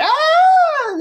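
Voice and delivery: loud, very high-pitched sustained scream; mock scream. Screams heard in the cemetery at night, hammed-up shriek. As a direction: shriek like someone out in the dark. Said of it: "you hear these screams from the dark".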